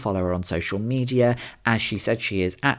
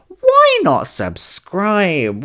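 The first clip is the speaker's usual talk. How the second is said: emphatic plug; 6 semitones higher, 8 dB louder, much slower than usual. Acted. Pushy podcast outro plug.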